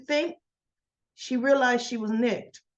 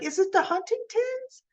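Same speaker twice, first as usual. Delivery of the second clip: anxious question, pitched much higher; worried mother; 13 semitones higher than usual. Retold or imitated. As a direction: ask it fearing the worst. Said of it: "and she's like".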